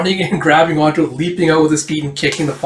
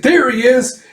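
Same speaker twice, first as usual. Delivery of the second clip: high, excited call to the others; acted alarm, pitched right up; spotting cry; 11 semitones higher, 4 dB louder, slower than usual. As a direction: point out the quarry urgently. Pursuers spot the intruder.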